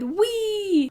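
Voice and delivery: put-on high squealing wee of a child flying; gleeful wee. A narrator's reading. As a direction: squeal it like a kid being swung. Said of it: "I shouted".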